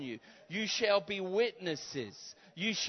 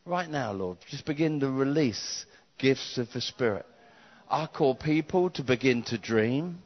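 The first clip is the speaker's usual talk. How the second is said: prayer; 4 semitones lower than usual. Acted. Prays over the congregation.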